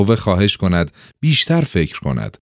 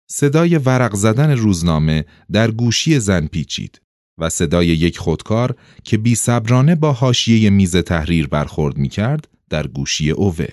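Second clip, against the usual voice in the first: ordinary delivery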